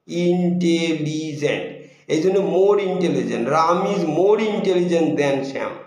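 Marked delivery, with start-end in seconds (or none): 0.1-1.7 slow ইন্টেলিজেন্ট with its four beats spelled out; syllables drawn out. Shown as a demonstration. Teacher counting syllables of ইন্টেলিজেন্ট aloud. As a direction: stretch ইন্টেলিজেন্ট beat by beat. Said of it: "চারবার উচ্চারণ করতে হচ্ছে".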